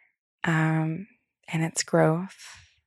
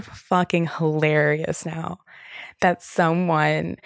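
ordinary delivery

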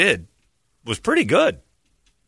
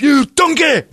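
high, loud scornful yell; angry chef insult; 9 dB louder, slower than usual. Retold or imitated. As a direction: spit out the insult like a raging chef.

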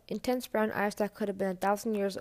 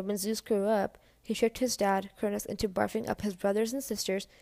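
usual speaking voice

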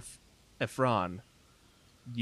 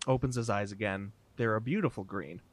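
normal talking voice

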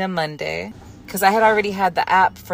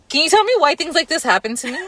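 exasperated outburst; 10 semitones higher, 6 dB louder, faster than usual. Retold or imitated. Retold exasperated outburst at him.

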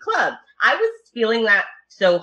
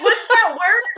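high, laughing question, clearly louder; laughing confused question; 4 dB louder than usual. Retold or imitated. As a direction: laugh while asking for the word. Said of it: "I'm like".

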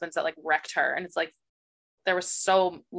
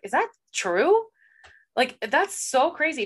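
high, slow disbelief; slow, high-pitched amazement; incredulous; 7 semitones higher, slower than usual. Retold or imitated. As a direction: ask it as if you can't believe it. Reacting in disbelief to book twist.

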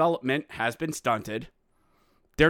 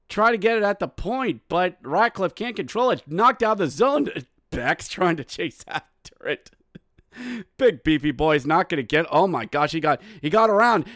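higher, urgent hockey play-by-play; 3 semitones higher, 4 dB louder than usual. Real, not acted. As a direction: follow the puck with rising excitement.

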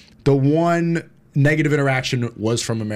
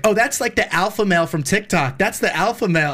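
higher-pitched mock gossip voice; imitating the girls; 5 semitones higher than usual. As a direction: mimic a girl recognizing someone, pitched up. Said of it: "and then they're like".

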